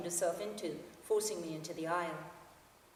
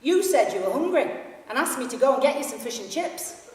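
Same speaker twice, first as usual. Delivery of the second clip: loud, higher Yorkshire boom; 6 semitones higher, 11 dB louder than usual. Acted. Yorkshire fan tells his story so the carriage hears, loud. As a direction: broadcast it to the whole carriage. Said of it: "in such a loud voice".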